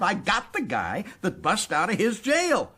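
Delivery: usual speaking voice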